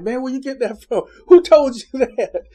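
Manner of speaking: imitating his own flustered surprise; startled. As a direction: caught off guard, laughing it off loud. Acting his own startled reaction.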